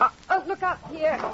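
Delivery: sudden high startled cry of warning; a quick frightened look out; alarmed warning. Acted. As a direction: blurt it out in alarm. Wife sees danger at the door.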